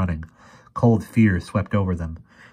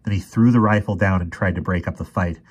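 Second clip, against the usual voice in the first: ordinary delivery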